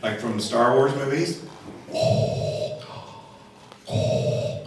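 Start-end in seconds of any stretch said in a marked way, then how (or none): 1.9-4.6 slow mechanical mask breathing; Darth Vader. Acted. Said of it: "like Darth Vader".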